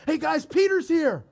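high, excited call to the room; excited announcement. Acted. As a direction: burst in with the news. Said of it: "She's so excited".

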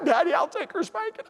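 put-on high-pitched little girl's plea; imitated crying child. Little girl offering to take sister's spanking, imitated crying. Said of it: "four-year-old girl cried".

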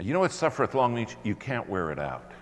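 ordinary talk, nothing marked

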